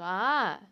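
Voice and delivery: loud sudden shout of surprise; startled yell. Real, not acted. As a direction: yelp out, caught off guard. Startled reaction while running. (grito assustado)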